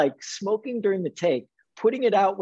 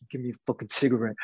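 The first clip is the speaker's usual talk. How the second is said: lower, softer, fast grumble; desperate demand, fast; 7 semitones lower, 4 dB quieter, much faster than usual. Retold or imitated. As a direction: blurt it out, craving. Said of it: "I was like".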